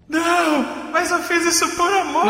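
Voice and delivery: mock plea. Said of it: "era a hora que você tinha que falar".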